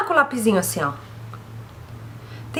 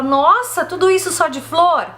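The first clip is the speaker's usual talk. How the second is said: shocked client; 6 semitones higher than usual. Retold or imitated. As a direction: sound floored by the price. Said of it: "a pessoa fala".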